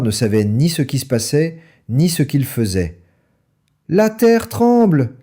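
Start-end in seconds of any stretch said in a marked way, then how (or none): 3.9-5.1 high, slow cry of alarm; frightened cry. A narrator's reading. Mrs Fox cries out to her family, high and slow with fright. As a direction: cry out as the ground shakes. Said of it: "cria Dame Renard".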